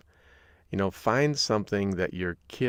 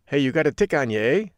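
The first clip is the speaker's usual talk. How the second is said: put-on playful Upper Peninsula accent, higher and louder; Yooper accent; 6 semitones higher, 7 dB louder, faster than usual. Host showing listeners how Yoopers talk, mock Yooper accent. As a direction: put on a Michigan Yooper accent, ending on a lifted "eh". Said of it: "They usually have an accent".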